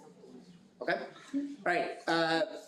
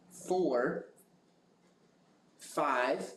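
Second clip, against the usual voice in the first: slow number list with long pauses between items; slow reading; much slower than usual. Real, not acted. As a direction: read each number separately, leaving time to write it down. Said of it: "I'm gonna read this slowly".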